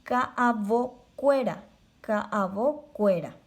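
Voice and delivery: slow, careful ka'avokuéra; teacher modelling ka'avokuéra slowly; Guarani word. Shown as a demonstration. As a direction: spell out ka'avokuéra syllable by syllable. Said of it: "Se dice".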